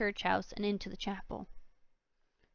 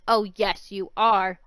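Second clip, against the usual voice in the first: gloating; 8 dB louder, slower than usual. Acted. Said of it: "Loxy said".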